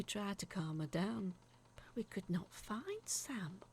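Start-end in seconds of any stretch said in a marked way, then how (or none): none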